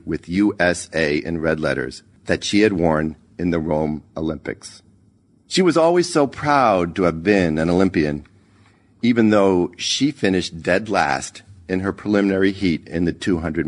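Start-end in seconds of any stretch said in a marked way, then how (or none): none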